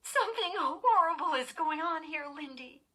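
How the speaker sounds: high, tearful distress; tearful stammer. A narrator's reading. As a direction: cry it out, fading on the last word. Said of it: "Chris stammered through her tears".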